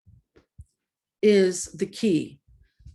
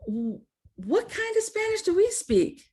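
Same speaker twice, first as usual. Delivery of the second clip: put-on much higher, small puzzled kid voice; childish question; 12 semitones higher than usual. Young daughter asking her dad, puzzled kid voice. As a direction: ask it like a confused young kid. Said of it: "I'm like".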